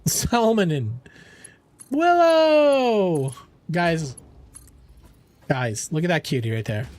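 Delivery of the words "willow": happy, loud hello across the room; excited greeting. Real, not acted. Greets Willow arriving in the stream, loud and delighted. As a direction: call the name out loud and high, drawn out.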